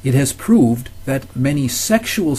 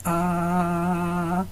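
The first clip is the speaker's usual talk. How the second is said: long, steady "Ah" at a higher, softer pitch; imitated little girl; 5 semitones higher, 5 dB quieter than usual. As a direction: imitate a child holding out an "Ah". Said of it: "a little girl saying".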